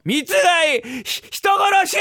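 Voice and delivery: put-on high-pitched childish screaming demand; tantrum shriek. Boy demanding syrup from his father, tantrum shriek. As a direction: escalate into a hammed-up cry of murder.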